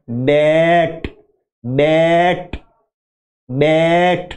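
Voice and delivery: बैट with mouth wide open, bleat-like vowel; बैट said with a wide open sheep-like a; bleating sound. Shown as a demonstration. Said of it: "मुंह फाड़ के बोलती है".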